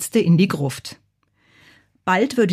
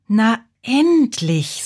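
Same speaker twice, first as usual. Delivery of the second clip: slow, drawn-out higher sigh of relief; relieved sigh; 4 semitones higher, much slower than usual. A narrator's reading. Bazilla hears her brother is finally back, sighing. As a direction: stretch it out like a sigh at last. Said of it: "seufzte Bazilla".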